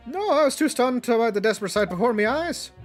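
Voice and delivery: lightly voiced Irish old man; Irish accent.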